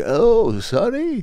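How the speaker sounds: lightly voiced old man, high; a hint of a creaky old man. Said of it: "if he had old man voice".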